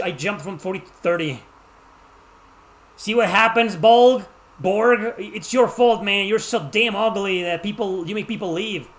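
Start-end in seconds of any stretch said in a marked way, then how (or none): 3.0-8.9 loud, raised-pitch mock outrage; mock scolding. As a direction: playfully blame him, high and loud. Mock scolding of a viewer in chat.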